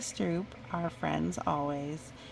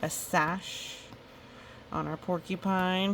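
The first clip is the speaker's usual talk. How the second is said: usual speaking voice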